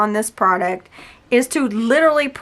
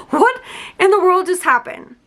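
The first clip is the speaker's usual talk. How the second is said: high, incredulous exclamation; real, high-pitched bewilderment; astonished; 9 semitones higher, 4 dB louder than usual. Real, not acted. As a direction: blurt it out in amazed disbelief. Astonished by powder changing color.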